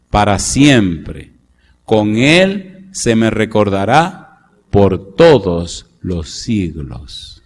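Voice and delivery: slow, measured Bible reading; scripture reading. Acted. Preacher reading Exodus slowly to the congregation. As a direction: read the verses deliberately.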